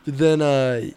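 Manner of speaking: usual speaking voice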